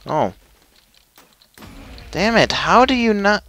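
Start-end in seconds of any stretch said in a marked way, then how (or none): 2.1-3.4 high-pitched exasperated outburst. Real, not acted. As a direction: protest in frustration at the game. Frustrated at a game glitch.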